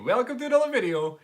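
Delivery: high, loud American YouTuber greeting; American accent, bright video intro; hammed-up American vlogger welcome. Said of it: "I just love the American accent".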